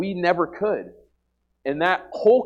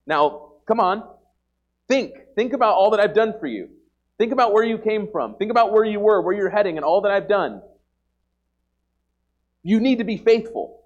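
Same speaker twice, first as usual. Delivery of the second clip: higher and louder, urging each think about; stern pleading; 5 semitones higher, 5 dB louder than usual. Acted. Preacher voicing God urging his people to remember, loud and stern. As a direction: drive it home like a father correcting.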